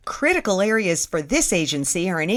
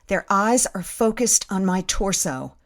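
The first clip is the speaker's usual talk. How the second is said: ordinary delivery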